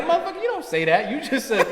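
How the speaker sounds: high, fast, laughing scold; mock exasperated telling-off. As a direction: scold a friend through laughter. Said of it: "I was like".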